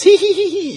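high giggly tittering; schoolgirl giggle. Retold or imitated. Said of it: "we're like little schoolgirls".

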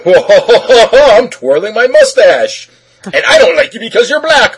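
loud, high, hammed-up cartoon villain; mustache twirling villain. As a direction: ham up a mustache-twirling bad guy, laughing. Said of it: "it would have been like".

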